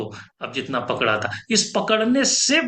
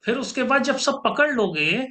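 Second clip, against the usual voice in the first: ordinary delivery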